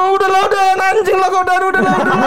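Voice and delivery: hammed-up desperate surrender in a fight; retold pleading yell. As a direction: beg him to quit mid-scuffle. Retold fight, begging to stop.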